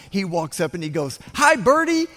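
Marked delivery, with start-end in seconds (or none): 1.3-2.1 much higher and louder, sing-song greeting; squeaky little voice. Retold or imitated. Littlest guy greets the bird in a squeaky voice. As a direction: call out brightly like the littlest kid. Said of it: "you say it like that when you're reading the book".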